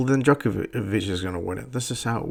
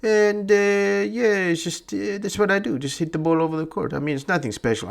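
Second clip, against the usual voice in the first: high-pitched imitation of an interviewed player; Federer; 9 semitones higher than usual. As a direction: play the nonchalant champion in a raised voice. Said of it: "He just goes".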